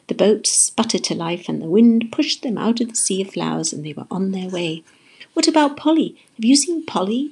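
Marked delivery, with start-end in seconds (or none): 5.3-7.3 high, urgent questions; shouting. A narrator's reading. Jessie asking a big turtle about Polly, shouting. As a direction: call out the questions anxiously. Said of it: "Jessie shouted to a big turtle".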